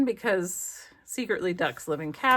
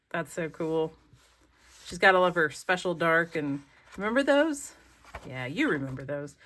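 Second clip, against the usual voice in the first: usual speaking voice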